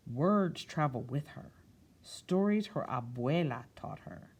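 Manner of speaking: picture-book narration; storytime reading. A narrator's reading. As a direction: read it to a room of children. Reads picture book aloud to children.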